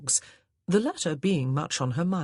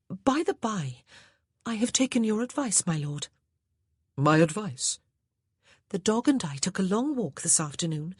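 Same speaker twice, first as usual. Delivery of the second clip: put-on higher, lilting young lady; higher ladylike voice; wife's voice; 5 semitones higher than usual. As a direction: sweetly suggest the wine. Wife character speaking.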